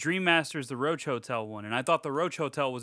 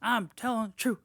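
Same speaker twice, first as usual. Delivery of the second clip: high, put-on voice of insistence; exaggerated pleading protest; mock protest; 7 semitones higher than usual. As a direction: insist like someone nobody believes, hammed up. Acting out Alice pleading.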